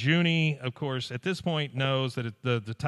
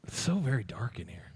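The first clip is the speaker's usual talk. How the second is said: spooky whisper about the dark; 2 semitones lower, 7 dB quieter than usual. Real, not acted. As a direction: whisper it like a ghost story.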